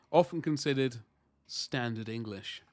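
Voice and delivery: normal talking voice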